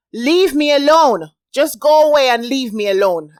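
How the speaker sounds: loud, high shout of "leave me alone". A narrator's reading. Marcia shouting at him through the door, loud. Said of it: "I shouted".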